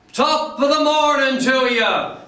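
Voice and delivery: high, hearty Irish greeting called out; rousing top-of-the-morning holler; gusto and spirit. Acted. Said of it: "Spoken with gusto and spirit".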